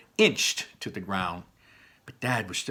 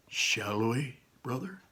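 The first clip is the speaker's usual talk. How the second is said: softer hushed question; soft invitation; 7 dB quieter, slower than usual. Acted. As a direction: invite him gently. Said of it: "Barley said".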